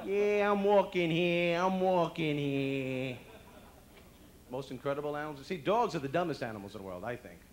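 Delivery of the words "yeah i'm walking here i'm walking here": very slow, loud drawn-out swagger; Brooklyn cat. Acted. Cat strutting down the street, loud Brooklyn swagger. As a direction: stretch the words with Brooklyn attitude. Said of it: "every cat in the world has a Brooklyn attitude".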